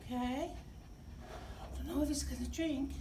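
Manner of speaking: ordinary delivery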